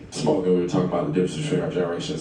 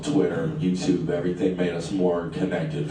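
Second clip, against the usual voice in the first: ordinary talk, nothing marked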